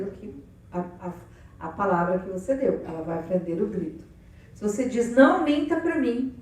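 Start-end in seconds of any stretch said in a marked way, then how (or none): 5.1-6.3 louder stern scold; scolding parent. Retold or imitated. Parent scolds the child for lying, louder.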